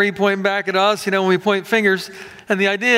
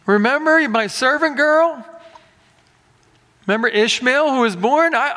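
higher, pleading Abraham talking to God; mock Abraham; 6 semitones higher than usual. Abraham tells God he already handled it, higher mock plea. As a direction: play Abraham reminding God of his own plan. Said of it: "He decides".

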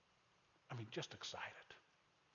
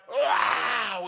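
"wow" shouted much higher and louder; a big amazed shout; excited wow; 8 semitones higher, 11 dB louder than usual. Shown as a demonstration. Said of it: "and write".

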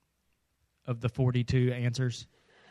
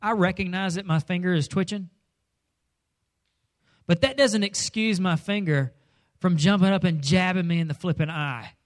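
ordinary delivery

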